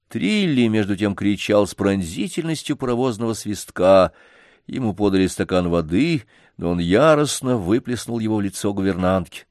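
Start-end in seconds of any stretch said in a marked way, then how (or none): none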